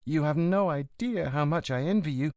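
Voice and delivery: high signora voice reading fondly; signora reading letter. Acted. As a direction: lift into her voice, tender remembrance. Signora reads old letter aloud.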